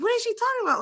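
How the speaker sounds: high, puzzled inner question; baffled. Retold or imitated. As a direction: think it, high and bewildered. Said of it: "And I was like".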